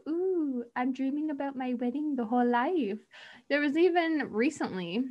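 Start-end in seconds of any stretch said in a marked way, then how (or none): 0.1-3.0 mock dreamy bride. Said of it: "the typical, like".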